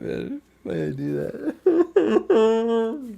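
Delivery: high, slow, laughing question; high laughing whine. Real, not acted. Laughing at own challenge.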